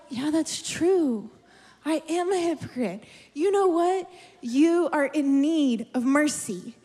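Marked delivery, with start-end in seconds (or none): 0.1-3.0 religious voice. Retold or imitated. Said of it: "it will come in a religious voice".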